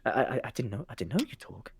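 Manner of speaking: soft, stuttered embarrassment; hushed, faltering admission; sheepish stammer. Acted. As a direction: stammer it quietly, caught out. Startled whisperer realises he was heard.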